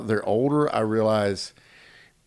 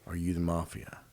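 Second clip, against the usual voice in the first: soft, low, hushed question; small, solemn kid's question; trusting child; 6 semitones lower, 14 dB quieter than usual. Retold or imitated. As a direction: ask Dad quietly and earnestly. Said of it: "he thinks I'm the greatest thing ever".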